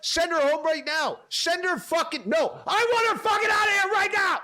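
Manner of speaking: high-pitched furious screaming tirade, rising to the peak; enraged boss screaming at a model; screaming rant. Retold or imitated. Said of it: "screaming in a way I can't even scream".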